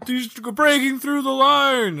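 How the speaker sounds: a mock announcer call, pitched well up; game voice line. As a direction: call it out like a game character warning you. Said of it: "to be like".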